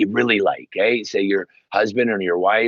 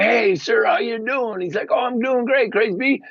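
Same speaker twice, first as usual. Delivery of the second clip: cheery greeting; 12 semitones higher than usual. Retold or imitated. Retold friendly greeting.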